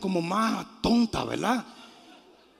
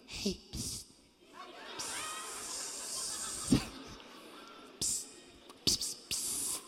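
soft, voiceless psst hisses; mock a sneaky psst to get attention; hushed attention-getting psst; 17 dB quieter, slower than usual. Preacher mocks a timid Paul calling the man, hissed psst. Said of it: "No, no, Pablo no le dijo".

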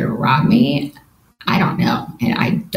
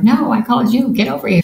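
higher-pitched urgent phone plea; urgently insisting, re-enacted; retold urgent plea; 9 semitones higher, faster than usual. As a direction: retell an insistent call for help, pitch jumping up. Retold urgent call to dad.

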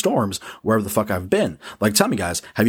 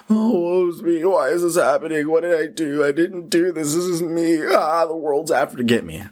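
higher, whiny woe-is-me voice, hammed-up; self-pitying whine; 8 semitones higher than usual. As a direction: play the sorry victim moaning at the world. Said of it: "I hit the".

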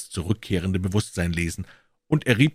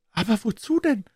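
higher voice, puzzled rising question; higher puzzled Pycroft. A narrator's reading. Pycroft asks Holmes why, in a higher puzzled voice. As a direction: ask it as the baffled clerk.

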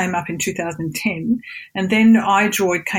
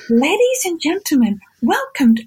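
sing-song announcer; 8 semitones higher than usual. Retold or imitated. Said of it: "a really sing-songy voice".

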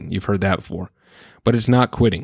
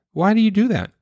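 put-on high-pitched talking-to-a-kid voice; parent's singsong question, pitched way up; parent to child; 12 semitones higher than usual. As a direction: imitate coaxing a pouty child. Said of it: "what I tell my kids".

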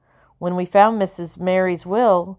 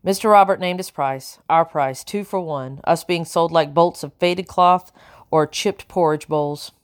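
usual speaking voice

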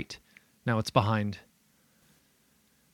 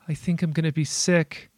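eerie mimicking voice; 7 semitones higher, 5 dB louder than usual. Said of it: "another voice bellows from a distance".

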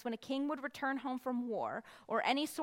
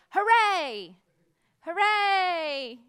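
high, loud churchy cheer; mock pious celebration; holy hooray; 6 semitones higher, 8 dB louder, slower than usual. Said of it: "in a holy way".

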